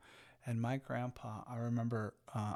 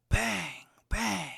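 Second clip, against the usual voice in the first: two sharp mock gunshot sounds, higher and louder; imitated gunshots; 8 semitones higher, 5 dB louder than usual. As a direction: pop out each bang like a gunshot. Gunshots heard from the field.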